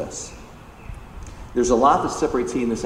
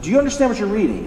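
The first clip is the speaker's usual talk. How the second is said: quick, higher-pitched emphatic question; intent and emphatic; 9 semitones higher, 4 dB louder, much faster than usual. Retold or imitated. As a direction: press the question urgently, imitating Philip. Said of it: "It's very intent and emphatic".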